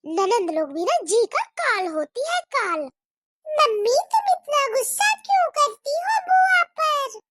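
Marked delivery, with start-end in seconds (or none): 3.5-7.2 put-on much higher, louder child asking; a small girl pleading with her mummy; little girl's voice. Child questions angry mother. (ऊँची बच्ची की आवाज़ में सवाल)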